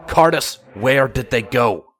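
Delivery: loud, urgent question; urgent call. Acted. Longshot pressing the captain for answers, urgent. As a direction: demand an answer fast.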